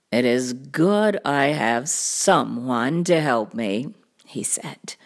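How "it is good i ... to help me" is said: low, deep cartoon cat voice; deep storybook cat; big cat. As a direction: say it deep and deliberate. Cat introduces first helper.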